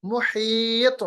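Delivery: slow मुहीत, long ई and echoed त; qalqalah demo. Shown as a demonstration. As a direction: stretch मुहीत, then bounce the त. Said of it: "वापस लाना". (खींचकर मुहीत, तौ का क़लक़ला)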